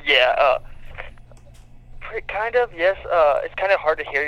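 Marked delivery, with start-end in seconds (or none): none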